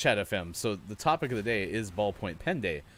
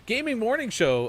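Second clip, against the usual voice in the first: high, loud announcer hype; show host intro; 13 semitones higher, 8 dB louder than usual. Shown as a demonstration. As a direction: announce the show with big energy. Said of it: "Can I get a rewind, please?".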